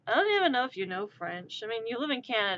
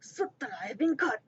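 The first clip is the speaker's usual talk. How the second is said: hammed-up French Canadian accent; fake French accent. Caught syrup smuggler, fake French accent. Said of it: "my terrible fake accent".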